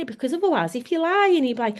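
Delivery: usual speaking voice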